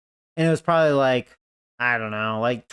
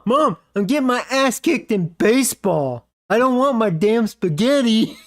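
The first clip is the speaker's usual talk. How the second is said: mock kid yell; 7 semitones higher than usual. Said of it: "he's telling his mom".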